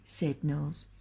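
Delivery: plain reading voice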